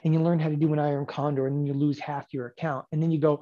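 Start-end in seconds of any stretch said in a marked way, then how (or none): none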